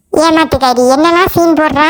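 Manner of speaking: low-pitched threatening grumble; scornful. Acted. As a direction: call out the faker in a low voice. Sceptical mother watches excuses. (நம்பாமல் மிரட்டும் தாழ்ந்த குரல்)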